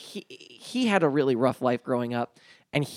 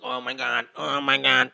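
whimpering friend; 4 dB louder than usual. Retold or imitated. Friend on the floor after the burning ointment, mild mock whimper. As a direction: imitate a friend whimpering in pain. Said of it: "he starts kind of, like, whimpering".

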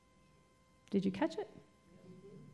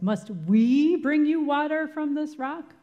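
angry; 6 semitones higher, 5 dB louder than usual. Retold or imitated. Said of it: "where he says".

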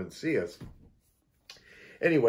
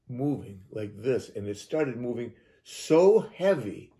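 ordinary talk

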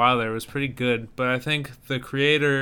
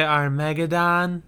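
imitating her; 4 semitones higher, slower than usual. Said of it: "She's like".

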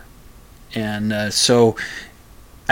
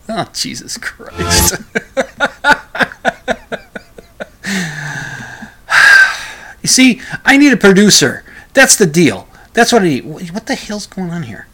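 exasperated laughing; 8 semitones higher, 4 dB louder than usual. Real, not acted. Exasperated as the clip fails.

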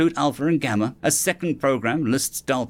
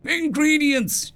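howling; slower than usual. A narrator's reading. Jack howls for ingredients.

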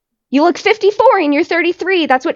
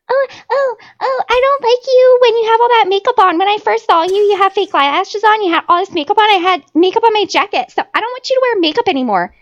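much higher, whining mimicry; mock whiny imitation; 6 semitones higher than usual. Imitating JP whining about her makeup, high and mocking. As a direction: whine it like the guy she mocks.

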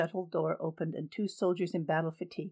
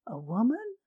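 aghast; 5 semitones higher than usual. Acted. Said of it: "Maru looked aghast".

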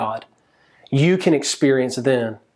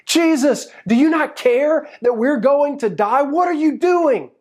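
high-pitched frantic yell, acted out; panicked screaming; 12 semitones higher than usual. Disciples screaming at sleeping Jesus in the storm, panicked yell. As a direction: cry out in alarm, voice pitched way up. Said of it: "they start screaming at him".

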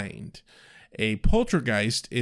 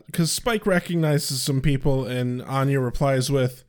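normal talking voice

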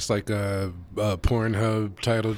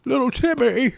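put-on much higher, wavering old-movie voice, faster; Jimmy Stewart impression; 11 semitones higher, faster than usual. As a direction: squeak it like a jittery old-film hero. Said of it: "He was all like".

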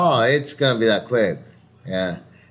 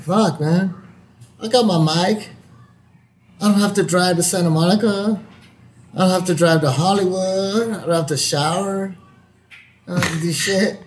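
higher-pitched, marvelling at the easy setup; pleased realization; 6 semitones higher than usual. Retold or imitated. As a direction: count off the perks in a raised voice. Retelling relief at home auditions.

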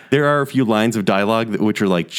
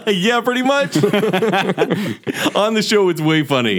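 high, laughing through words; giggling while answering; 9 semitones higher than usual. Real, not acted. Laughing at being teased.

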